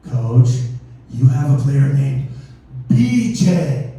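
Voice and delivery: low, loud, deliberate delivery; pointed explaining. Retold or imitated. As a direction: spell it out firmly for someone who missed it. Tells coach the obvious problem.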